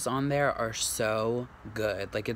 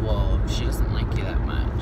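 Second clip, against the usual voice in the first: teasing; 4 semitones lower, 6 dB louder than usual. Real, not acted. Teasing partner.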